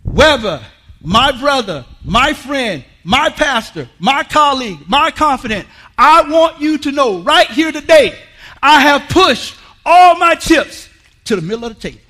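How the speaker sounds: a hint of proud all-in announcement; retold bold declaration. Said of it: "And I said to him".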